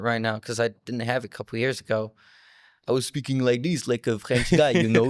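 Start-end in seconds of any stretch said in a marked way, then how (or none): 2.9-5.1 heavy French accent on English words; thick French accent. Shown as a demonstration. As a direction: sound like a French guy just arrived. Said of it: "this is the accent".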